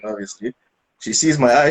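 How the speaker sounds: ordinary talk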